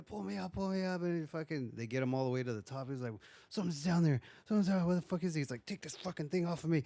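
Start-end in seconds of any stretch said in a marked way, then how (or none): 0.0-1.0 high-pitched mock panic, urgent repeats; panicked plea. Man on a rope calling to be hauled up, mock panic. 3.5-5.4 high, frantic retold alarm; panicked warning. Man warning friends of something below, panicked high voice. Man back at top, terrified. 5.7-6.8 high, rushed mock panic; frantic demand. Man at the top wanting the rope off, frantic demand.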